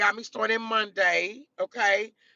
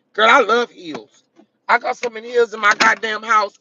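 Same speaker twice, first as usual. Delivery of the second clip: loud gushing; 8 dB louder than usual. Real, not acted. Telling viewers about her heels, loud and gushing. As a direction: declare it to the whole chat.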